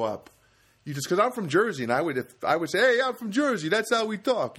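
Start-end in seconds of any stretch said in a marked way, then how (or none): 2.8-3.7 put-on high, punchy Jersey accent. Comedian playing a local defending how Jersey talks, exaggerated Jersey accent. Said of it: "Do they talk like that". 3.7-4.6 high-pitched mock Jersey accent. Comedian explaining how his home state talks, hammed-up Jersey accent. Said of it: "Do they talk like that".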